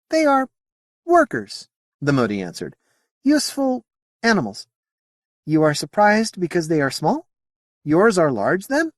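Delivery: high, lilting alien voice; a curious alien, politely puzzled; alien Modi voice. Acted. Alien answering Sally's question in a high voice.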